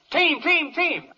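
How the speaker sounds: high chanted team cheer; football cheer. Acted. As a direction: cheer like the crowd at a game. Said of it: "football yells".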